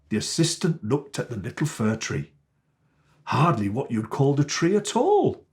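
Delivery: ordinary delivery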